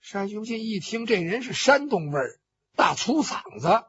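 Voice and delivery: plain reading voice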